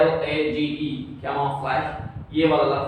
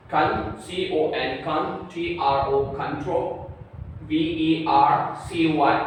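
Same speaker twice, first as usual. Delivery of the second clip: slow spelling; slower than usual. Shown as a demonstration. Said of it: "spelling syllable by syllable".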